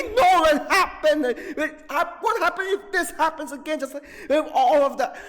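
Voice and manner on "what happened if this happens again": normal talking voice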